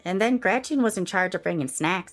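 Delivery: gossipy Karen. Acted. Karen gossiping about the neighbours' snacks, catty. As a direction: dish the dirt sweetly.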